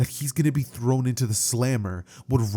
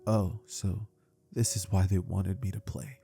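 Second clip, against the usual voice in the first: softer, lower inner thought; quiet realization; 4 semitones lower, 7 dB quieter than usual. Acted. Naruto realizing the kids' trick, quiet and hurt.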